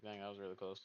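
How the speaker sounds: usual speaking voice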